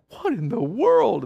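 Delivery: high, drawn-out astonishment; bewildered deer. Retold or imitated. Deer after the chaos, bewildered and drawn-out. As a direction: stretch it out high, utterly baffled. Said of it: "The deer are like".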